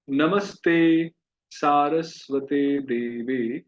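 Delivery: very slow, syllables drawn out; slow recitation. Shown as a demonstration. Teacher models the next line for students, very slowly. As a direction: chant the line slowly for repetition. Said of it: "मेरे पीछे उच्चारण कीजिए".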